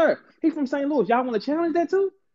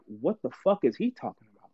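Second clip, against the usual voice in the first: low, hushed disbelief; baffled onlookers; 13 semitones lower, 7 dB quieter than usual. Acted. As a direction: mutter it low, like a puzzled bystander. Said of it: "Saint Louis's are standing and looking like".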